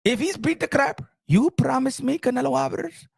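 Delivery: put-on high-pitched, heavy-accented boss ranting; the Turk impression. The Turk scolding Canelo over the Crawford fight, high and ranting. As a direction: lecture Canelo like the man who pays him, rising to shouts. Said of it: "You understand the Turk?".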